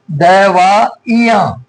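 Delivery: दवाइयां very slowly as "da-vaa-i-yaan"; syllable-by-syllable. Shown as a demonstration. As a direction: spell out दवाइयां by ear, pausing between syllables. Teacher breaks word to show spelling.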